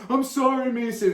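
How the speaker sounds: high, loud mock sorry; cowed kid apology. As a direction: squeak out a forced sorry. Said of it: "He's like".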